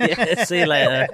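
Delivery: laughing through a mock goodbye; laughing send-off. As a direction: giggle out the brush-off.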